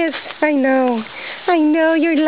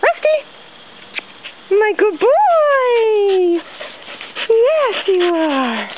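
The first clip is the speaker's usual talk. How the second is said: high, excited, drawn-out praise; praising a dog; 9 semitones higher, much slower than usual. Real, not acted. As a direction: squeal his name, stretch the praise slowly.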